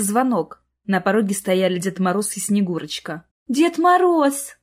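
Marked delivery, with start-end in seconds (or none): 3.5-4.6 put-on high, drawn-out child's wonder; little girl in awe; awestruck babble. A narrator's reading. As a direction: say it spellbound, stretching the name. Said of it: "пролепетала Соня".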